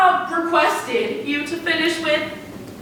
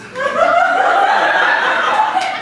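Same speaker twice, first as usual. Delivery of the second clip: weary laugh; 13 semitones higher, 3 dB louder than usual. Acted. Assistant laughing alone.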